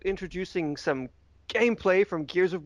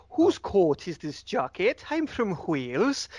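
put-on Welsh accent; 3 semitones higher, slower than usual. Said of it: "if you're speaking Welsh, you have to really get in there with the vowels".

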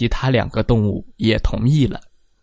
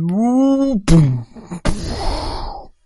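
elephant blast; 8 semitones higher, much slower than usual. Acted. Elephant blows out breath.

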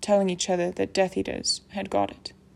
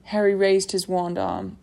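usual speaking voice

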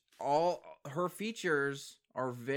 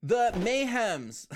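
excited; 10 semitones higher, 6 dB louder than usual. Real, not acted. Excited over lyric reference.

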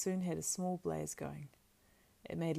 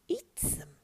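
creature voice at ordinary pitch; urging creature. A narrator's reading. Gollum urges eating the rabbits raw, voiced.

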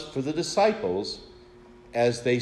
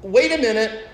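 a startled disciple calling after Jesus, much higher and louder; 6 semitones higher, 4 dB louder than usual. Retold or imitated. Disciples calling after departing Jesus, raised voice. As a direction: call out to Jesus, hey wait a minute, raised and urgent. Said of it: "and to say".

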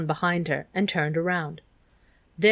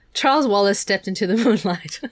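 louder, breaking into a real laugh; 2 semitones higher, 5 dB louder than usual. Real, not acted. Reader loses it over the sentence, really laughing. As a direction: crack up while reading the line.

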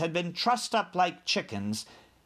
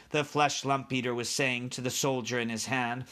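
ordinary delivery, nothing marked

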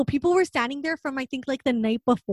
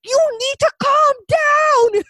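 high, slow, loud mock shout; stern man; 13 semitones higher, 7 dB louder, much slower than usual. A stranger shouts at the hysterical mother, high and slow. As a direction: bark it out like a man scolding a crying woman. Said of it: "some guy's like".

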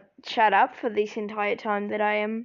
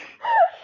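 giggle; 5 dB louder than usual. Real, not acted. Giggling at own fact.

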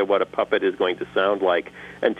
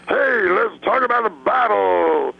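slow gravelly jazz-singer preacher voice; a slow Pentecostal preacher in Satchmo's voice; [name]; 10 semitones higher than usual. Retold or imitated. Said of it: "I had done an impression of [name]".

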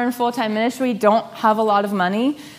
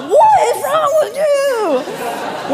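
much higher, louder, slow, laughing; laughing mock yell; 5 dB louder, much slower than usual. Husband [name] to wife at the house, laughing mock yell. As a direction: blurt it out cracking up in disbelief.

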